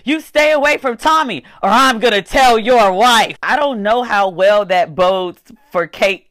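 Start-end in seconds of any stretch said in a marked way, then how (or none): 0.1-3.4 threatening mother. Retold or imitated. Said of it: "And she's like".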